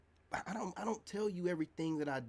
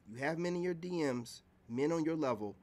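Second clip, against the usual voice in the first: ordinary delivery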